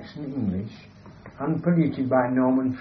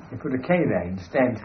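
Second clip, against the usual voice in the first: ordinary delivery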